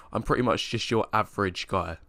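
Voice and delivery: usual speaking voice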